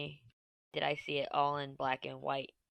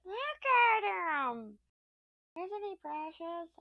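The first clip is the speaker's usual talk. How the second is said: cooing; 13 semitones higher, much slower than usual. Real, not acted. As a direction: coo over a cute puppy. Cooing over Scotty dog picture.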